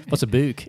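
"book" echoed with a long "oo", as in "boot"; mimicked accent on BOOK. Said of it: "Can you do it in his accent?".

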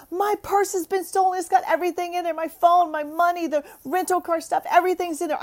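high-pitched retold panic to the manager; panicked plea. Mother tells store manager her purse is stolen, high panicked voice. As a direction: rush it out like your purse was just stolen. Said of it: "I'm panicking".

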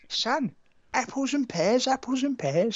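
high, hammed-up Cockney rhyming slang; mock Cockney. Brit mocking Cockney slang for American friends, hammed-up Cockney. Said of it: "Fucking Cockney rhyming bullshit".

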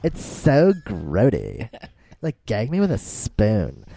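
slow, low mock Valley Girl. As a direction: drag out the Valley Girl slang. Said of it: "Like a Valley Girl?".

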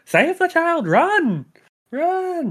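high frantic cries of a tiny creature; little being begging them to run; small being yelling. Acted.

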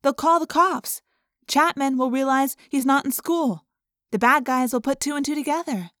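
high, insistent protest; worried objection, pitched high; arguing. A narrator's reading. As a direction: argue urgently, pitch held up throughout. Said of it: "Cassie argued".

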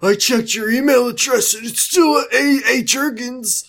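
high, mock angry Ricky; a hammed-up cross husband; angry Ricky text. Ricky angrily texts Amy about her email, mock angry. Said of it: "text her angrily".

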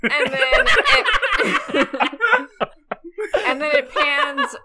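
high laugh-through words; laughing, squeezing the words out. Real, not acted. Laughing mid-recap.

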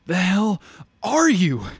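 high, loud, pained shout at the captor; a demand hurled at the creature; angry shout. Acted. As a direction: yell it out through the pain. Captive Lucia yells at her captor.

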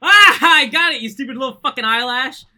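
high, loud triumphant shout; triumphant yell. Real, not acted. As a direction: yell in victory over the eyelash. Catches the hair in the mouth.

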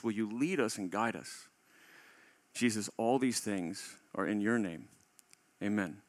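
low, soft prayer voice. Real, not acted.

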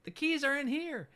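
high-pitched mock yell; calling out. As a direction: call it out high, like someone stuck in a trunk. Retold cry from the locked trunk.

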